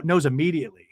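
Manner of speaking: normal talking voice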